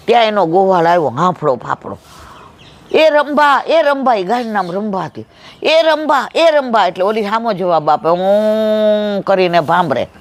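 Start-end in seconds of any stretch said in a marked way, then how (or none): 2.9-4.1 high calling cry to a cow. Acted. Herdsman calling his cow. 5.6-6.9 high calling cry to a cow. Acted. Herdsman calling his cow. 8.1-9.2 cow moo. Acted. Cow lowing in reply.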